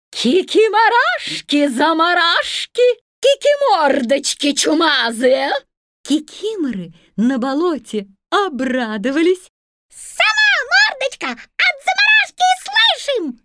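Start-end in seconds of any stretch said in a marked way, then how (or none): 0.1-5.5 higher teasing shout; fox shouting. A narrator's reading. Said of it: "как крикнет". 9.9-13.4 very high squeaky sprite voices; squeaky swamp sprites. A narrator's reading. Кикиморы дразнят лису.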